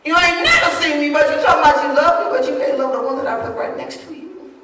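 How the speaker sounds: high, loud preaching shout; God's challenge. Retold or imitated. Preacher voicing God rebuking those who cannot love, shouting high. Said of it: "God said".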